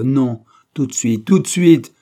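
slow, loud, rising to a high shout; violent order. A narrator's reading. Employer dismissing his chauffeur at once, violent shout. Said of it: "dit violemment sir Felton".

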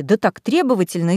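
ordinary delivery, nothing marked

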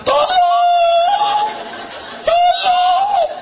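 very high, stretched mock cry for help; screaming thighs. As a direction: squeal for rescue like squeezed thighs. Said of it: "sampai menjerit tuh paha". (paha menjerit minta tolong, melengking)

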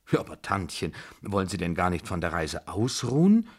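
ordinary delivery, nothing marked

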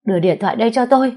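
fast, high, loud command; commanding order. Acted. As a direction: bark the order for the phone. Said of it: "vừa nói như ra lệnh".

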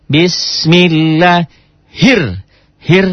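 "bismillahir" slow and drawn out, no alif lam; slow recitation. Shown as a demonstration. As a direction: glide into the doubled r. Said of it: "alif lamnya jangan dibaca".

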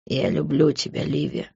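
soft low whisper of love; hushed tender whisper. A narrator's reading. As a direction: breathe it to her quietly. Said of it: "прошептал он".

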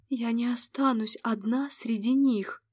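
ordinary delivery, nothing marked

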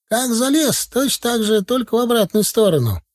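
very high grandad calling instructions; old man's shout. Acted. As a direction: call up like an old countryman, pitch way up. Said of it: "закричал дедок". (дедок выкрикивает высоко)

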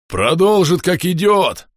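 booming certainty; rumbling. A narrator's reading. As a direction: roar it out confidently. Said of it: "пророкотал Бом". (громогласно, раскатисто)

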